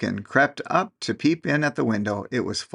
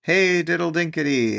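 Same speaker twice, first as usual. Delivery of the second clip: teasing mice; 6 semitones higher than usual. A narrator's reading. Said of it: "answered the little mice".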